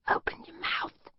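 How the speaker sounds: hushed, muffled whisper. Acted. Captor ordering the captive to drink, muffled whisper. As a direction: whisper it through a mask. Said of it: "he said in his muffled whisper".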